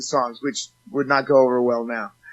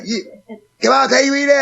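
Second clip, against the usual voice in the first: higher, louder pushy fan begging; pleading fan; 9 semitones higher, 5 dB louder than usual. Retold or imitated. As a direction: beg like a fan who won't take no. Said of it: "He's like".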